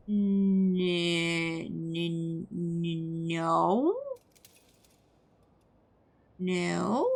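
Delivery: drawn-out stammered no, rising into a question; unconvincing, questioning denial; hesitant denial. Acted. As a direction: stall on the n, then ask it. Stalls answering the guard's suspicion.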